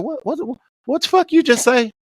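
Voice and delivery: high, stuttering menacing demand; retold angry challenge. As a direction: imitate him rounding on her. Ray Ray turns on her threateningly.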